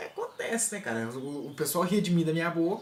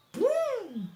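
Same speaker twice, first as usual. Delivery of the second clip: high mock pop noise; eye pop sound. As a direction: squeak out the eye twitch. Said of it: "Fazia assim, ó". (som de pum agudo)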